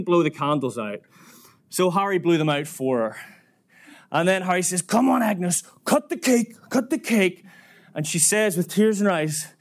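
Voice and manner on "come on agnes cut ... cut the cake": high, insistent coaxing; raised-pitch repeated prodding; impatient urging. Retold or imitated. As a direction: imitate a man egging someone on. Harry urges cake cutting.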